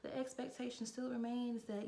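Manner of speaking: manager imitated in a high voice; high retold voice, easing down toward the end; Moana. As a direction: mimic the boss insisting, pitched well up. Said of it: "And she was just like".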